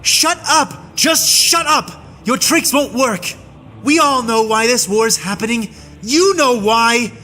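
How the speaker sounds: high, furious bellow at his captor; a soldier bellowing hatred at an alien; bellowing in rage. A narrator's reading. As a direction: roar it out, pitch climbing with fury. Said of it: "he bellowed".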